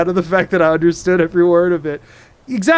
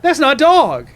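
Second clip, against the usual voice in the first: mock surprise; 9 semitones higher, 6 dB louder, slower than usual. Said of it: "I'd say".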